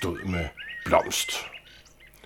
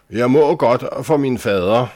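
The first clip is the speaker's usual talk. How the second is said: curt, sulky, higher and louder retort; short and sulky; 6 semitones higher, 6 dB louder than usual. Acted. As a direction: snap back like a spoiled little lady. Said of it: "sagde hun kort og mut". (kort og mut)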